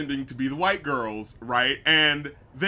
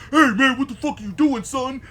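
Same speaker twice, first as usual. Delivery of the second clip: loud, much higher, hammed-up street tough; put-on tough guy voice; 9 semitones higher, 6 dB louder than usual. Podcaster mocking the brother answering a catcaller, loud hammed-up tough. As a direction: call out the catcaller like a swaggering tough. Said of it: "still having, like, the deep voice".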